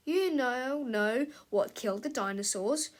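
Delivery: plain reading voice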